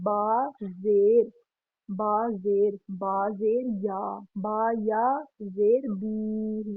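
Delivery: slow, deliberate letter-by-letter recitation; slow spelling out. Shown as a demonstration. Teacher spelling a word for students, slow and deliberate. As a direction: spell each letter and vowel mark slowly for the class. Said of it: "जैसे देखें".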